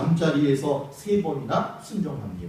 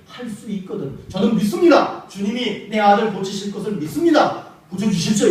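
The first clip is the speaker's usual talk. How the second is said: loud, high, firm plea of faith; believing plea; 7 semitones higher, 5 dB louder than usual. Retold or imitated. Father begging Jesus for his son, loud believing plea. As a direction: plead with full faith. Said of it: "이렇게 해야지 믿음이 있는 거잖아".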